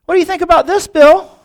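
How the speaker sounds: high, loud mock fury; hammed-up vituperative biker; incensed taunt. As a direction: shout the challenge, hitting the name hardest. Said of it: "he was incensed".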